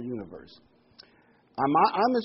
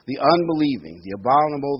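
forceful scripture reading; 3 semitones higher, 9 dB louder than usual. Acted. Reads scripture list forcefully.

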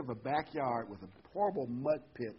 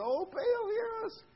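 thin-volume falsetto plea, hammed-up; mock scream; slower than usual. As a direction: imitate desperate worshippers crying out in a squeal. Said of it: "they're screaming".

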